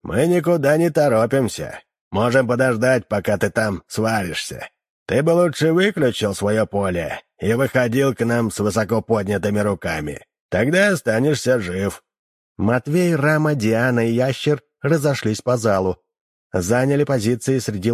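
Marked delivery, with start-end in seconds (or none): none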